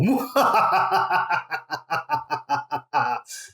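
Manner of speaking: evil wizard cackle; gloating villain laugh. Acted. Villain laugh after evil plan.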